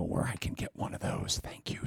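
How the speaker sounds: whispered mock request. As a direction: whisper it like a secret aside. Mock aside to old man.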